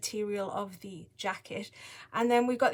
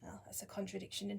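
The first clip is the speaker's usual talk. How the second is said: whispering; 2 semitones lower, 14 dB quieter, faster than usual. Real, not acted. Hushed aside to herself.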